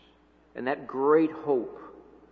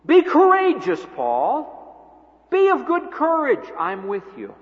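a much higher, ringing call of encouragement; Jesus encouraging; 12 semitones higher, 7 dB louder, slower than usual. Acted. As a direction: lift the voice high as Jesus urging Paul on. Jesus voiced speaking to Paul.

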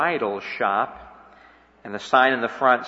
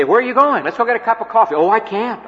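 high, louder, lively acted exchange; voiced street chat; 8 semitones higher, 4 dB louder, faster than usual. Preacher acting a chat with a busy student, animated. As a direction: play both sides of a quick street chat.

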